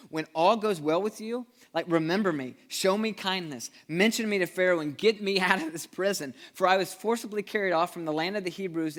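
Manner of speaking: loud, high, pleading plea from prison; pleading Joseph. Acted. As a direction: beg to be remembered. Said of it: "It says".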